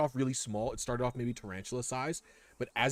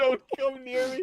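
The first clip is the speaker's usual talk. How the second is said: high squeal; 13 semitones higher, 5 dB louder than usual. Acted. Repeat in high voice.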